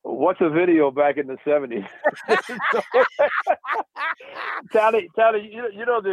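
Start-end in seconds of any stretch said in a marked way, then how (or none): 1.9-2.7 a sudden high-pitched laugh; amused high giggle. Real, not acted. Co-host laughs at seventies video. 2.9-4.1 a sudden high-pitched laugh; amused high giggle. Real, not acted. Co-host laughs at seventies video.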